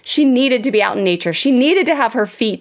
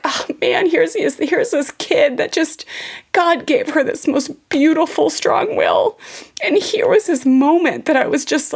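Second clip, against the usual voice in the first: higher, choked-up inner thought; emotional realization; 7 semitones higher than usual. Real, not acted. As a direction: say it like it still moves you. Emotional realization retelling daughter's will.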